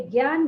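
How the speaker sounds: ज्ञान with a hard g, "gyaan"; mispronounced as gyan. Shown as a demonstration. Teacher rejects a common error, ज्ञान as "gyaan". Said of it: "ज्ञान नहीं बोलना चाहिए इसको".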